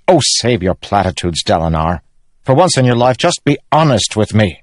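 pleading, exasperated nephew; exasperated young king. Acted. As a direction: cut your uncle off, fed up. Elhokar rebukes Dalinar.

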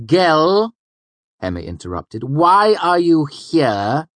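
high, loud, slow cutting master; cold Hemme. A narrator's reading. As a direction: cut the boy off coldly. Hemme interrupts the student.

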